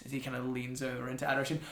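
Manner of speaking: ordinary delivery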